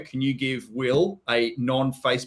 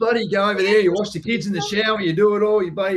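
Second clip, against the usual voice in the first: mimicking his own Aussie tradie spiel, higher; hyped walkthrough patter; 6 semitones higher than usual. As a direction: rattle it off like a cheeky builder showing a house.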